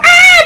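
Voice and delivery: loud, very high-pitched imitated scream. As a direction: mimic a panicked scream at full volume. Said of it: "I hear this screaming".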